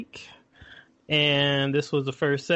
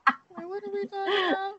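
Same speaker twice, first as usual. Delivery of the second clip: confused; 5 dB quieter than usual. Retold or imitated. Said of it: "I'm like".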